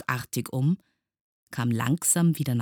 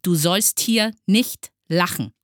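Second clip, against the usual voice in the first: loud, higher, emphatic order; commanding; 5 semitones higher, 7 dB louder than usual. A narrator's reading. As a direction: forbid it sternly, word by word. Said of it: "befahl Herr Studienrat Groll". (streng befehlend)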